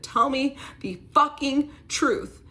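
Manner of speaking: high, loud, slow scream. Retold or imitated. In her partner's face, a slow furious scream. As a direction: scream it right in his face. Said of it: "I scream in his face".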